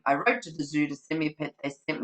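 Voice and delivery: fast, skimming repeat; rattled off quickly. Shown as a demonstration. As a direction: rush through the familiar line. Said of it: "it keeps going, keeps going".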